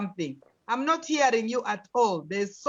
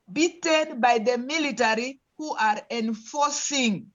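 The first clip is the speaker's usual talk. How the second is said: ordinary delivery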